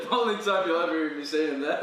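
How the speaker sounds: laughing, then joking through it; amused laughing. Real, not acted. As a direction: still laughing as he talks. Laughing at his own joke.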